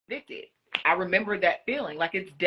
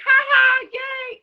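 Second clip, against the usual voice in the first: mock gleeful cheer; 9 dB louder, much slower than usual. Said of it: "they're like".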